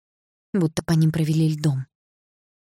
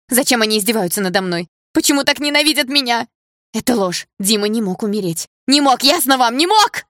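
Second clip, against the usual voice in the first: loud anguished protest climbing to a scream; hysterical denial; 7 semitones higher, 6 dB louder than usual. A narrator's reading. Polina refusing to believe Dima died, hysterical denial. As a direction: build from loud complaint to high-pitched scream.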